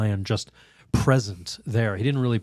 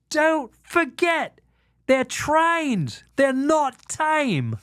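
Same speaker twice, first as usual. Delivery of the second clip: put-on high, slow warning called from down the train in an Australian accent; Australian trainer hollering along the train; much slower than usual. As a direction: stretch it out like a shout carried on the wind.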